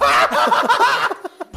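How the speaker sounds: high loud cackle; shrieking laugh. Real, not acted. Laughing at own bit.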